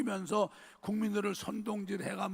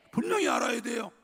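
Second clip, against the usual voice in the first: loud, higher call with 분명히 hit hard; emphatic shout; 4 semitones higher, 5 dB louder than usual. Real, not acted. Preacher insisting to congregation: loud emphatic shout. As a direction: shout it so the whole room hears. Preacher driving point home.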